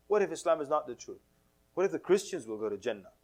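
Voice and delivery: ordinary delivery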